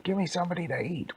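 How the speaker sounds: high, rapid hungry wolf; frantic storybook wolf; ravenous wolf. Acted. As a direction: demand it fast and high. Ravenous wolf demands food.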